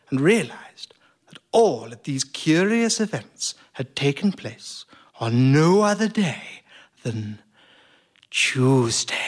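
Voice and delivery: slow, hushed, suspenseful reveal; dramatic build-up. Acted.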